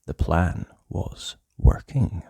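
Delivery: slow secret whisper; conspiratorial whisper. A narrator's reading. Narrator confiding the plan to listeners, whispered.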